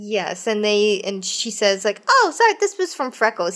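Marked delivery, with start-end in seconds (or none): 2.1-3.5 put-on little girl voice. Creepy girl quoted cheerfully.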